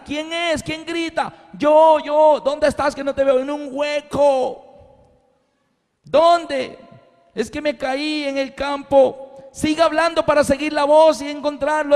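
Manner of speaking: high, long-distance hollering held in check; acted calling out. Farmer and man in a hole searching for each other, mock hollering. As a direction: call back and forth across a field. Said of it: "alguien grita".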